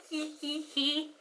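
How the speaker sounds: tiny, soft mock tee-hee; imitated giggle. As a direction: giggle very quietly, like a tipsy prankster. Imitates own drunken giggle.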